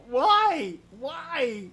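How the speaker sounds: high, loud, drawn-out lament; hammed-up wailing plea; mock anguish. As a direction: cry out to the heavens in fake despair. Mock despair over a bad joke.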